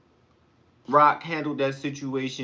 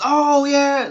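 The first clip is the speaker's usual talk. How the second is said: much higher, louder cheer "Oh, yeah"; joining the yell; 11 semitones higher, 8 dB louder than usual. Retold or imitated. Yelling along with the Super Bowl crowd, high and loud. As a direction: belt it out with everybody. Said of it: "that's when I just".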